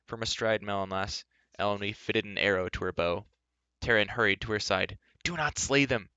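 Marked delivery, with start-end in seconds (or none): 5.2-6.1 high-pitched plea to hold back; urgent cry. A narrator's reading. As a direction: cry it out in alarm, pitch raised. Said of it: "he cried".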